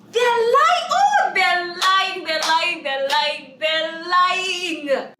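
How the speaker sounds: high, loud, sing-song they're lying; calling out liars in a sing-song; emphatic sing-song. Real, not acted.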